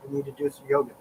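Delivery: normal talking voice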